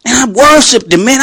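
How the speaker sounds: usual speaking voice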